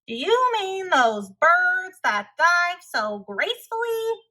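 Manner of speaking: Tacky's voice. Acted. Tacky teases the puzzled hunters, goofy and bright. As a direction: ask it like a cheerful clown. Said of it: "Tacky asked".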